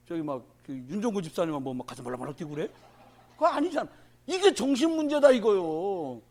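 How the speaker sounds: ordinary talk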